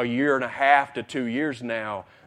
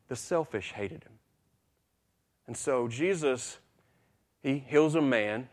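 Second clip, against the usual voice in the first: usual speaking voice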